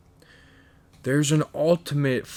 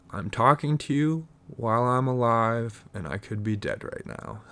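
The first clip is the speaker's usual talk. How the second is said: ordinary talk, nothing marked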